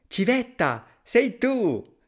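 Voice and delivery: high, bright hello to a friend; cheery greeting. Acted. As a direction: call out gleefully. Mouse greets owl.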